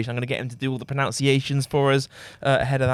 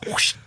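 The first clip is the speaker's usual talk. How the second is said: commentator's whoosh. Retold or imitated. Imitating the commentator's whoosh for his co-host. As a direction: blow out a quick whoosh like a commentator. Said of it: "the sound effects he always does".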